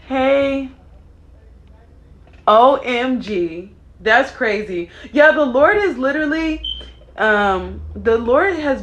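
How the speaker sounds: high, loud amazed outburst; excited. Real, not acted. Reacting to viewers about the new song, amazed and loud. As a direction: caught off guard, thrilled.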